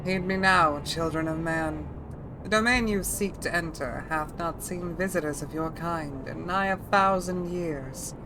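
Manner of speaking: put-on throaty older woman dragon voice. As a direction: ancient dragon speaking with rasp. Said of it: "Feeling the Odile in that dragon voice?".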